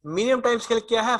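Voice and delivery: ordinary delivery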